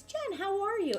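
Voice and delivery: retold greeting, pitched well above own voice; supervisor's greeting. Supervisor checking on Jen at work, high imitated greeting.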